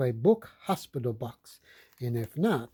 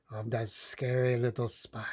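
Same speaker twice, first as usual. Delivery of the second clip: whispered, creepy word; spooky whisper; 4 semitones lower, 5 dB quieter than usual. Acted. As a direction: hush it like a scary secret. Scary spider in story.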